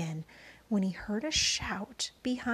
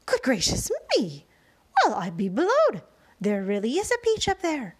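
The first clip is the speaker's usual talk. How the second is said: astonished exclamation; 6 dB louder than usual. A narrator's reading. Sponge astonished at peach.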